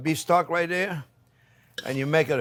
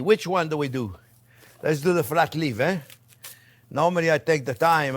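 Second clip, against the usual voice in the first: normal talking voice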